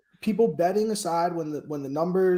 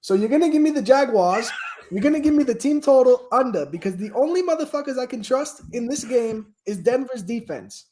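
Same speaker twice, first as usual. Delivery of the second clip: higher-pitched attempt at an English accent; 6 semitones higher, 4 dB louder than usual. Panelist trying his best on request, in a put-on English accent. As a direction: have a go at sounding English. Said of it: "Do it in an English accent".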